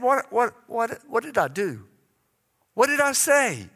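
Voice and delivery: flustered, pleading questions; retold upset panic. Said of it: "I was so upset".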